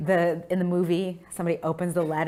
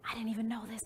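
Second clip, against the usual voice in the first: whisper; 4 semitones higher, 10 dB quieter, faster than usual. Retold or imitated. Telling mama about the uniform.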